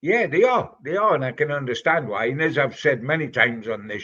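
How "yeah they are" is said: yeah pitched high and rising, a little louder; excited agreement. Real, not acted. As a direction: jump in eagerly to agree. Eager agreement with the caller.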